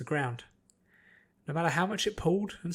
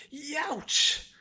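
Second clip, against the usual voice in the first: high sharp cry of pain; pained yelp; 13 semitones higher than usual. A narrator's reading. Applejack slashed on the forearm, pained yelp. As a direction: yelp as the claw cuts. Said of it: "she cried".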